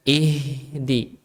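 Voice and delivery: "ihdi" with a long airy h; flowing h. Shown as a demonstration. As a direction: stretch the h before "di" in "ihdi". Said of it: "Ya, dialirkan hurufnya".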